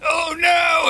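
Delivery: high shouted mock panic. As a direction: cry out in high cartoon fear. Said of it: "you're like".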